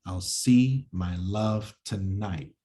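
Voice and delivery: slow, stressing every second syllable; slow metrical scansion; iambic reading. Shown as a demonstration. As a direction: read it in da-dum rhythm. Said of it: "an example of this in actual words".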